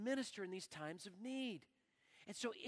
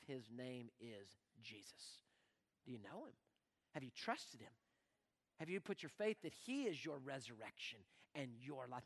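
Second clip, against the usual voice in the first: hushed altar call; 10 semitones lower, 9 dB quieter than usual. Real, not acted. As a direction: drop to a near whisper, much softer.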